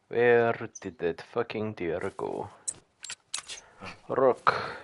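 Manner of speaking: light mock Russian accent; tired, half-hearted Russian accent; drifting into a Russian accent while hunting. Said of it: "Do I know why I'm doing a Russian accent?".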